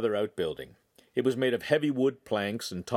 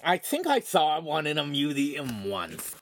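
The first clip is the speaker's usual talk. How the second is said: lisping, higher goofy voice; mock lisp; 5 semitones higher than usual. A narrator's reading. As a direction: talk with the tongue twisted. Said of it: "twisting his tongue ... so he lisped".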